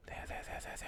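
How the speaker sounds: tense unvoiced whisper. Real, not acted. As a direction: hush it while tracking. Tracking target while aiming.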